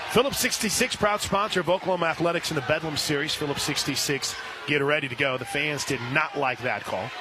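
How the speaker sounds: ordinary talk, nothing marked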